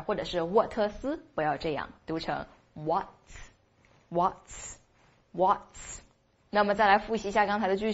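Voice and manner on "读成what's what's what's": "what's" said very slowly as one joined sound, ending in ts; what's drilled. Shown as a demonstration. Teacher drills students on joining "what's" into one syllable, very slow.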